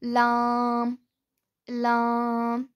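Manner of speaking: लाम light, tongue edges to the gums of the top teeth; लाम: light l, long a; Arabic lam. Shown as a demonstration. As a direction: say लाम thin, not heavy. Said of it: "इसको भी हम बारीक पढ़ेंगे".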